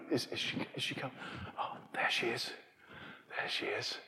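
whispered eager waiting; soft whisper, there she is; hushed crowd. Retold or imitated. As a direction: breathe it like you're craning to see the Queen. Acting crowd waiting for Queen.

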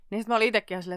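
ordinary talk, nothing marked

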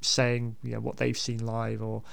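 ordinary talk

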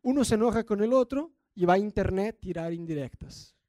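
ordinary delivery

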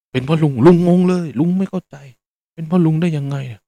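high, loud disbelief at the blame; baffled outcry. Retold or imitated. Old taxi driver protesting the blame, loud and high. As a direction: cry out "how is it my fault?".

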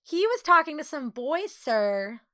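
put-on high-pitched telltale child; tattling boy. A narrator's reading. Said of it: "tattled another boy".

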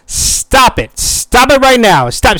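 loud, high mock yell at a TV character; exasperated yelling at the TV; yelling at the screen. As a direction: shout at the dumb character to stop. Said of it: "I'm yelling at my screen like".

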